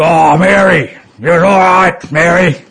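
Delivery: loud, high, leering bloke's drawl; blokey taxi driver. Retold or imitated. Taxi driver to passenger about Mary, loud leering bloke voice. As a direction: ham up a cheeky cabbie ogling a singer. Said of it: "in the way blokes do".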